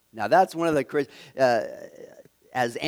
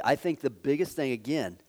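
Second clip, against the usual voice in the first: normal talking voice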